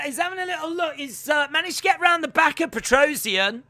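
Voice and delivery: loud, high excited fight call; animated sportscaster in full flow; play-by-play. Real, not acted. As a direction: build excitement as the grappling develops. Live fight commentary.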